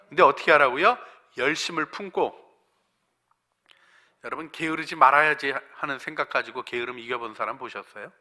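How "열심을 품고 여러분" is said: ordinary talk, nothing marked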